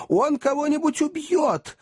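very high alarmed squeal; women's shriek. A narrator's reading. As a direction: squeal the warning in panic. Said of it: "завизжали женщины".

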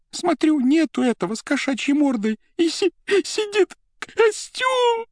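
high stammer into a long howl; wailing. A narrator's reading. Secretary tells of the empty suit, howling. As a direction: break up the words, then wail. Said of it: "завыла Анна Ричардовна".

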